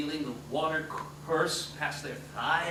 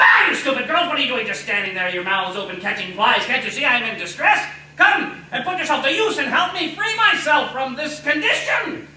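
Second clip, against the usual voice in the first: loud, higher scolding dwarf voice; angry dwarf; 6 semitones higher, 9 dB louder than usual. Acted. Dwarf scolds the staring girls, loud angry rant. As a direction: berate the gaping girls.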